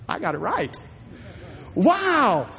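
mild amazement. Acted. Woman amazed by Jesus.

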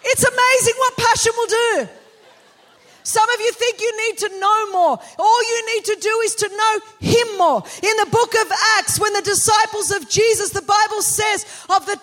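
high, loud, driving sermon delivery; impassioned rallying cry from the pulpit; full-force preaching. Real, not acted. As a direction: preach it to the back row, building to a climax.

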